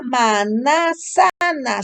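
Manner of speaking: "manasana" with an extra a after man; wrong word in four drawn-out syllables; mispronunciation demo. Shown as a demonstration. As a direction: stretch out ma-na-sa-na slowly. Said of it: "¿Se oye bien?".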